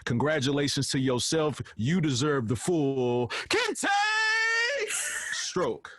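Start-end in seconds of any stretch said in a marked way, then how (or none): 1.8-5.9 drawn-out tribute. Pretend. Ceremonial tribute to the host.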